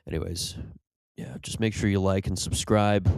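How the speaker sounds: bitter, over-loud ad voice cracking with despair; a ruined man forced to read an ad; broken sad sponsor read. Acted. Comedian mock-reading an ad, loud and bitter.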